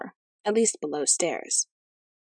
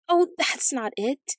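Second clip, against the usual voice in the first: high, slow plaintive wail; mock sobbing; 7 semitones higher, 3 dB louder, slower than usual. A narrator's reading. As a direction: wail it slowly, lightly acted. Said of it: "sobbed her majesty".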